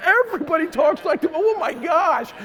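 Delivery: stunned exclamation on arrival; 1 amazed outburst. Retold or imitated. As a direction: blurt it out in disbelief. Shocked realization at border.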